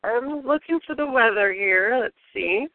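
ordinary delivery

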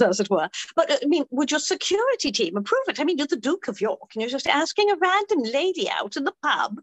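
high, incredulous British lady; hammed-up British commoner, swooping high; London accent. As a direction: question the Duke in a lilting London accent. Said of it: "I'll do the London accent".

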